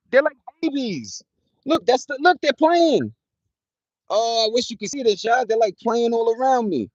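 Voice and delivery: high, delighted squeals at the critters; excited about squirrels. Real, not acted. Squirrels gather around him.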